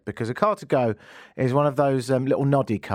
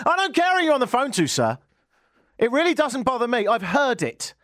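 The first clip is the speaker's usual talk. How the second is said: dismissive cop; 11 semitones higher than usual. Retold or imitated. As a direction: bark it like a bored cop. Policeman dismissing him, quarrel.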